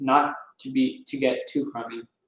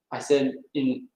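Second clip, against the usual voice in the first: ordinary delivery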